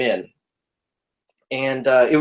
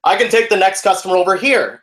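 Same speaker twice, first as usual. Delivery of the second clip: loud, raised projecting voice; theater voice; 5 semitones higher, 4 dB louder than usual. Shown as a demonstration. Cinema worker calling over the next customer, loud theater voice. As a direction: project it so it carries through the room. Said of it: "So you talk loudly".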